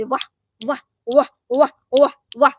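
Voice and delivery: punched, separated exclamations of fake praise; sarcastic applause. Real, not acted. Commentator applauding a double standard, sarcastic praise. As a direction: applaud mockingly, one burst at a time.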